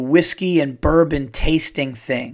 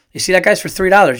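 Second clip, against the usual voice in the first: raised, louder joking plug; mock sales pitch; 5 semitones higher, 6 dB louder, faster than usual. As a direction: pitch it like a hawker, in jest.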